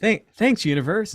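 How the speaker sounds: high, loud burst of grateful disbelief; amazed thanks. Retold or imitated. Man thanking the universe after sudden luck, amazed and loud. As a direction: cry out thanks to the sky.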